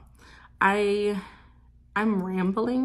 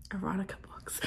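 embarrassed whisper; 9 dB quieter than usual. Real, not acted. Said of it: "That's what I wanna write".

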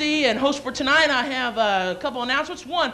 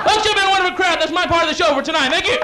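raised-voice show closer; loud sign-off; 3 semitones higher, 4 dB louder than usual. Real, not acted. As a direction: project a big closing thank-you. Signing off to the crowd.

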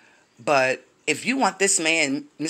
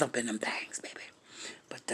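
whisper; 5 semitones lower, 12 dB quieter than usual. Real, not acted. Naughty gossip aside.

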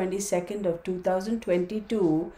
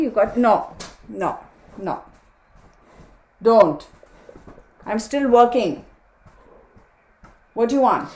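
firm scolding; 3 semitones higher, 7 dB louder than usual. Real, not acted. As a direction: tell the dog off sharply. Scolding the pushy dog.

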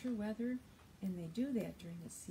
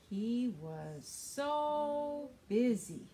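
slow, drawn-out so busy; stress on so; 6 dB louder, much slower than usual. Shown as a demonstration. Teacher leads children in the refrain. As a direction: stretch the word so for the kids to join. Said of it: "Help me say this".

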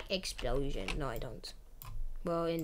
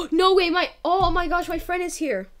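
high, loud thrilled squeal; bursting with delight at a friend; real excitement, higher and louder; excited; 8 semitones higher, 9 dB louder, faster than usual. Real, not acted. Friend joins the game.

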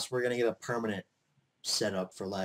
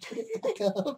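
laughing. Real, not acted. Teasing, laughing, high pitch.

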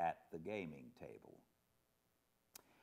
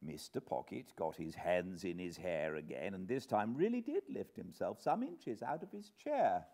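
ordinary delivery, nothing marked

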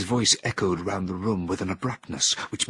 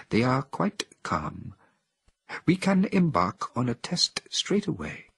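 higher, very slow reassuring voice; lightly voiced Helios; calm ape scientist; 6 semitones higher than usual. A narrator's reading. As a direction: soothe them slowly.

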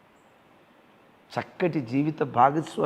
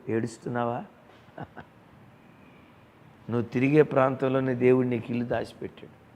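usual speaking voice

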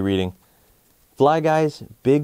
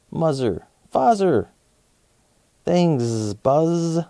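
buzzy fly talk; 5 semitones higher, much slower than usual. Acted. Fly Guy thanks Buzz, buzzy fly voice.

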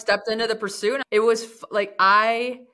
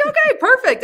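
excited; 8 dB louder than usual. Retold or imitated. Mom finds the perfect necklace.

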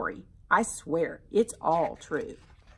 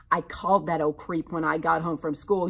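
usual speaking voice

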